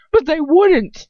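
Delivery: high, loud pleading protest; desperate. A narrator's reading. Ron refusing to believe it, desperate. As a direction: protest desperately, pitch jumping up. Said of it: "Ron says desperately".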